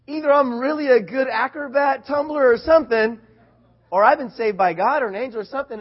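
high and loud, excitedly marveling; fired up. Retold or imitated. Unhurt boy reasons out how he survived, fired up. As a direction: work it out in loud amazement. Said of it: "I was so fired up".

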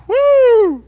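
a sudden high joyful whoop; loud high whoop. Real, not acted. Preacher whoops at his own joke, loud high whoop.

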